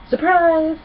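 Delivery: a playful dragon springing a surprise; excited shout. Acted. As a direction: leap out and shout it. Spike jumps out from the drapes.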